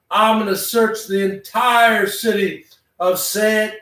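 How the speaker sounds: ordinary talk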